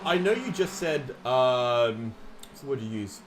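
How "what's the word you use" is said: normal talking voice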